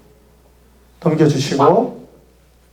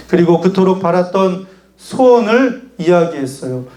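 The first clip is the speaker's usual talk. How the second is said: usual speaking voice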